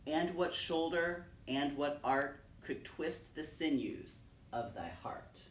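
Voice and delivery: slow, grave poem reading with long pauses; solemn recitation. Acted. Reciting William Blake for her son, solemn measured recitation. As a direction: let each question hang in the air.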